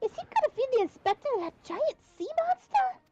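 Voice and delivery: alarmed. Acted. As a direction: gasp it out in shock. Alarmed character lines.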